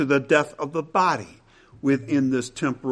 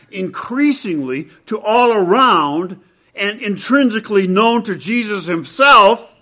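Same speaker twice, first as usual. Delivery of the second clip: fiery preaching; 7 semitones higher, 9 dB louder than usual. Acted. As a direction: build to a thundering pulpit peak. Preaching climax.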